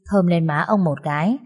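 plain reading voice

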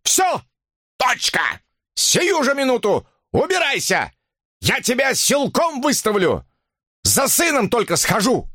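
high-pitched, emphatic outbursts; irate old man's eviction rant; enraged yelling. A narrator's reading. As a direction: yell each threat in a rage. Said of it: "орал он".

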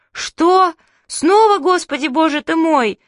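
loud, high-pitched panicked question; hysterical children. A narrator's reading. Children panic at Grandma's exclamation, hysterical. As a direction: shriek it back in childish hysteria. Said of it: "Зашлись мы в истерике".

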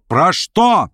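sudden agitated outcry; alarmed cry. A narrator's reading. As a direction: burst out with the question, pitch leaping. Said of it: "вскричал Верховенский".

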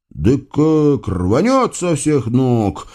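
ordinary delivery

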